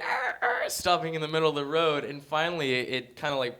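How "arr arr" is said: high unvoiced screech imitation; car brakes screeching. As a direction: mimic squealing brakes. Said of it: "cars are, like".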